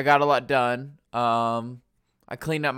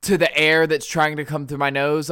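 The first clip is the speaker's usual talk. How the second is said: ordinary delivery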